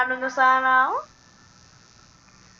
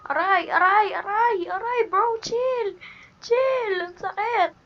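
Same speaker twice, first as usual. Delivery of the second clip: high pained yelps of ouch; pained yelling; 7 semitones higher, slower than usual. Real, not acted. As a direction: yelp like it really hurts. Getting hit in game.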